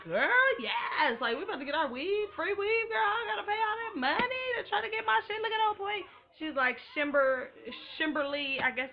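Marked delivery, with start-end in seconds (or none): 0.0-6.0 high, gushing excitement over a free weave; excited girl. Retold or imitated. To her friends about the free weave, squealing excited. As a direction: squeal it like you just won free hair. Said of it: "for me, that was like".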